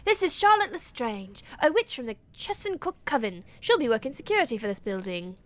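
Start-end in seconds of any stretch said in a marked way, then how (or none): none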